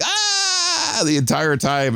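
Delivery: high, crying mock wail; imitated bug scream. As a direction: wail like a trapped fly. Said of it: "it's like".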